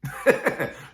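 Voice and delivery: quick breathy laugh; short laugh. Real, not acted. Preacher laughing at the idea of being begged, short laugh. Amused at nobody begging him.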